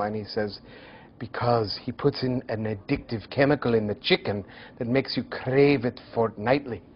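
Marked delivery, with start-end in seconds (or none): 1.2-6.8 mock Scottish conspiratorial line, crave it fortnightly; a clumsy Scottish accent for the punchline; poor Scottish accent. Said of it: "forgive my very poor Scottish accent".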